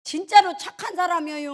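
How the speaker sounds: high, forceful plea of innocence; emphatic insistence. Acted. Insisting louder on second take.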